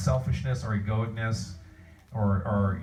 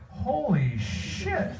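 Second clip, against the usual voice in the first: slow, high, stunned disbelief with a laugh; astounded; 11 semitones higher, much slower than usual. Retold or imitated. A fan recalls first seeing Dragon Ball Z, stunned and laughing. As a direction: let the shock stretch out, laughing. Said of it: "and I'm like".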